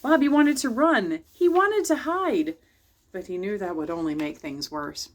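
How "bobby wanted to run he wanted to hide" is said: higher, louder, with rising panic; slow, higher and panicky; alarmed narration. A narrator's reading. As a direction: read it like the boy wants to bolt.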